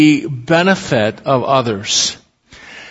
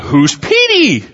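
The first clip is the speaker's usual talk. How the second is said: higher, puzzled inner question; puzzled thought; 9 semitones higher than usual. Retold or imitated. Hearing the neighbors' new pet name, puzzled surprise. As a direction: wonder who on earth Petey is. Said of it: "I thought".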